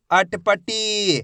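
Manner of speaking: अटपटी very slow, final टी held; teacher drawing out अटपटी; blended slow reading. Shown as a demonstration. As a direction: join अटपटी slowly, stretching the ee. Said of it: "एक एक अक्षर का उच्चारण करके".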